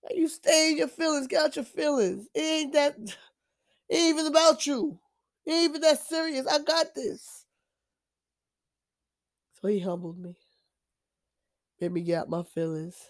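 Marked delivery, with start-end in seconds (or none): 0.0-7.5 higher, firm and blunt; a no-nonsense God setting them straight; God rebuking. Retold or imitated. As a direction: tell them straight to get out of their feelings. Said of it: "He's like".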